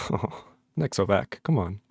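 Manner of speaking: ordinary talk, nothing marked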